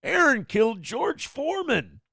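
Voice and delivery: high, mocking fan voice. Fans blaming Aaron for a celebrity death, high and mocking. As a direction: squeak it like an accusing fan. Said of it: "a bunch of people going".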